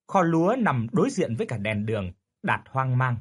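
plain reading voice

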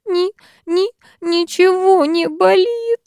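put-on high, slow, tearful little girl's whimper; a first-grader whimpering that nothing hurts; whimpering child. A narrator's reading. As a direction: stammer it out through sobs like a small child. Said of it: "негромко прохныкала".

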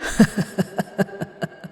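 soft, voiceless cackle dying away; evil ghostly chuckle. Acted. Ghost gloating over the doomed family, evil chuckle. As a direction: gloat in hushed bursts of laughter. Vengeful ghost mocking his killer.

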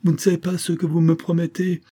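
high-pitched, insistent protest; impatient outburst. A narrator's reading. Sick woman doubts she can be forgiven, impatient outburst. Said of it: "s'écria Rachel Arden avec impatience".

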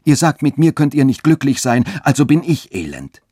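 high, loud, wretched protest; exclaiming. A narrator's reading. Rejected lover turns her words back on Katharina, exclaiming. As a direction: burst out in misery, pitched high. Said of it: "rief Friedrich aus".

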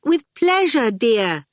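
high, gliding down sweetly. A narrator's reading. Dillion welcoming her friend in, sweet voice. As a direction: answer high and gracious. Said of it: "said the sweet voice".

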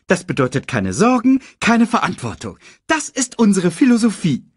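high, emphatic meerkat voice; upbeat meerkat. Acted. Meerkat explaining the motto to cub, high proud voice. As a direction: proclaim the philosophy high and loud.

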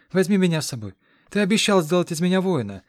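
put-on eager boy. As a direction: beg like a boy desperate to go. Boy pleads to come along.

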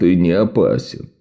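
low, grim and downbeat; glum. A narrator's reading. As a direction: say it with the corners of your mouth down. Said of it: "опустив уголки рта".

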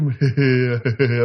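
low imitated "he-he" chuckle; teasing ghost chuckle. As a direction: mimic a ghost's teasing laugh. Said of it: "Ông cười hề hề như vậy".

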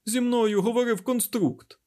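very high, loud announcement; barked. A narrator's reading. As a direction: burst out with urgent news. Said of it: "гаркнув Айзек".

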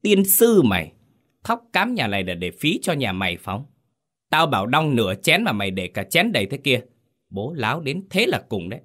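enraged mistress cursing; furious curse. Acted. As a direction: explode at the servant. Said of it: "rực mắt".

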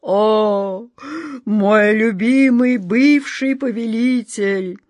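slow, timid, whining genie; a beaten dog of a genie pleading. Acted. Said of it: "С видом побитой собаки Джин робко".

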